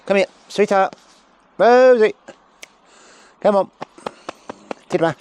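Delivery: high, loud call to a dog. Real, not acted. Trainer calling the sheepdog over.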